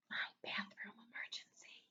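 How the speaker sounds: whispered title, unvoiced. Shown as a demonstration. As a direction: whisper it like a secret. Embarrassing title read secretly.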